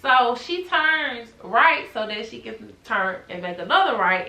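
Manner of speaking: ordinary delivery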